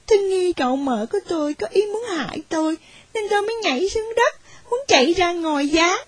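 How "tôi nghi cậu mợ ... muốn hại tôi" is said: usual speaking voice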